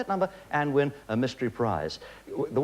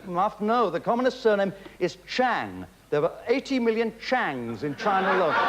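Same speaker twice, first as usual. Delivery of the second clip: ordinary talk, nothing marked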